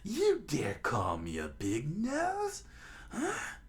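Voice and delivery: high, slow, strained villain voice; offended cartoon clown pirate; Buggy, affronted. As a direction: draw it out, rising to an outraged squeak. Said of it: "Buggy interrupted".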